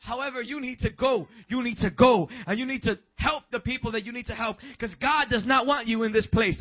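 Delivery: high, loud put-on voice of the boss; imitating the boss. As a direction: shout the boss's orders in his voice. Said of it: "This is how he talks".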